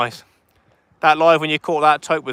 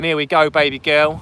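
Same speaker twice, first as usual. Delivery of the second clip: ordinary delivery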